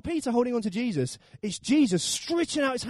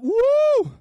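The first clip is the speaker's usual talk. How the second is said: loud, high "woo"; big swooping howl of wind; storm whoosh; 7 dB louder than usual. Acted. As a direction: imitate a roaring gale. Imitates the roaring storm.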